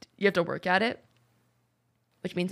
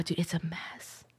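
whisper; 3 semitones lower, 10 dB quieter, slower than usual. Real, not acted. Gossip told in hushed tone.

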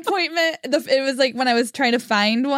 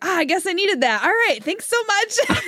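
cheerful relief; 6 semitones higher than usual. Retold or imitated. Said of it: "I was like".

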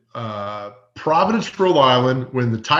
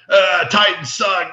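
drawn-out taunting fan voice, louder; slow mock heckle; 4 semitones higher, 4 dB louder, slower than usual. Patriots fans passing by Mike Keith, slow mock heckle. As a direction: sneer it slowly like a passing fan. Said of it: "people are coming by like".

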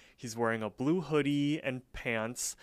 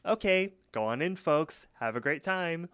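imitating the lady waving the kids in, higher and sing-song; counter lady voice; 4 semitones higher, slower than usual. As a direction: chirp out the welcome like a fussy attendant. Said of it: "The lady's all like".

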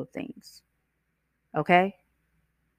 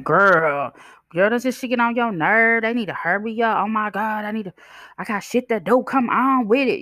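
higher, gossipy complaining; lady in line griping to the speaker, higher and impatient; impatient woman in line; 6 semitones higher than usual. Retold or imitated. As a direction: grumble to a stranger in the queue.

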